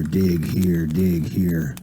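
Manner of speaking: plain reading voice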